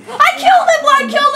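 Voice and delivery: triumphant shout. Real, not acted. Gamer wins a fight.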